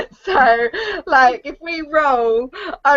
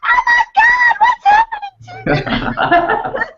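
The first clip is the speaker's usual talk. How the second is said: very high, loud put-on scream; hammed-up shocked shrieking; horrified mock shriek; 4 dB louder, slower than usual. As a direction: screech it in mock horror, slow at the end. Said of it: "And I'm like".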